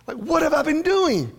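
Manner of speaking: high-pitched mock exasperation; exasperated parent. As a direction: act out a frustrated parent, voice shooting up. Parent's frustrated reaction, acted out.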